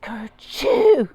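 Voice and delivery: a giant cartoon kerchoo; loud mock sneeze. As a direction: blast out a big fake sneeze. Sneeze sound in story.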